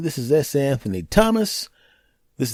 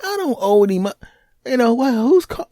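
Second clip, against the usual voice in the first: rising, higher, increasingly irritated half-sentences; annoyed telemarketer brush-off; 8 semitones higher than usual. Retold or imitated. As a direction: fend off a pushy caller, getting higher. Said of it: "and I'm going".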